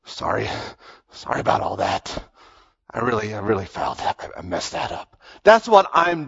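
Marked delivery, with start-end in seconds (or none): 0.0-5.1 hushed, breathy near-whisper, much softer and lower; sheepish apology. Pretend. Pastor imagines himself as Peter, sneaking off the boat, whispering sorry. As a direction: mumble an embarrassed sorry to Jesus under your breath.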